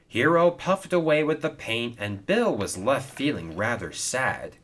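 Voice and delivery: ordinary delivery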